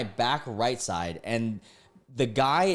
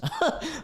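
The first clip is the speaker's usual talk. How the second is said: brief, high chuckle; small real laugh after a shrug-off remark; short laugh. Real, not acted. Laughs off getting no tip.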